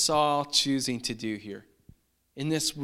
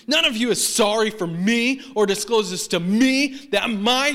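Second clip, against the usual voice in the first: raised, heated accusation; angry Saul; 5 semitones higher, 5 dB louder than usual. Acted. Saul accusing servants of conspiring against him, angry. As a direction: accuse them all, leaning hard on ME. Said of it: "You hear the anger in Saul's voice".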